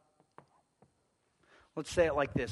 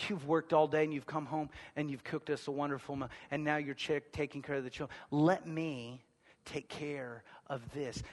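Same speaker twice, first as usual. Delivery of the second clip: tender offer; 2 semitones lower, 8 dB quieter than usual. Retold or imitated. Offering to do wife's dishes.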